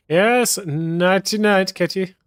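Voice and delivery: high, slow sing-song goodnight. Acted. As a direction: coo it like tucking in a pet. Saying goodnight to the cat.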